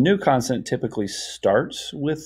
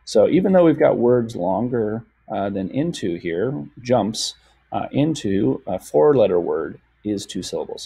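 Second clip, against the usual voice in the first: ordinary talk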